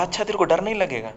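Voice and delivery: teasing. Acted. Friend teasing Mohan about fear.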